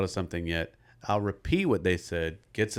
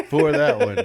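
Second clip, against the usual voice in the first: laughing; 10 dB louder, slower than usual. Real, not acted. Laughing while defending sitting.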